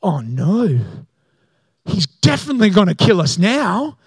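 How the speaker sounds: hammed-up sinking realisation; comic dread. Brothers' dread, comic contrast with cheer.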